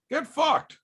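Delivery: high, snide brush-off; mock get lost. Streamer dismissing a chatter, sneering high jab. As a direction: toss it off with a sneering pitch jump. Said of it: "How's that?".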